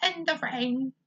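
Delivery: Cockney attempt, abandoned. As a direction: try the line in Cockney and miss. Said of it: "I can't do a Cockney accent".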